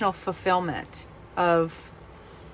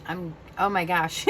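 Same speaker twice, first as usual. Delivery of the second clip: ordinary talk, nothing marked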